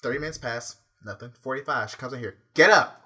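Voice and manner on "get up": loud, higher mock-mother order; imitated wake-up shout. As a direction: burst out like a parent rousing a sleeper. Mother rousing him, retold.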